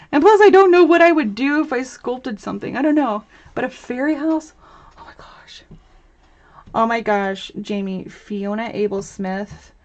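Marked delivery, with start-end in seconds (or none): 5.0-5.6 whispered. Real, not acted. Whispered reaction to fairy house idea.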